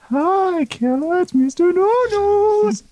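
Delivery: very high, drawn-out goofy falsetto; cartoonish put-on voice, slow on the sign-off; Mister No-Nos. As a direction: stretch the greeting out in a silly squeaky voice. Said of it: "And, and Mister No-Nos is".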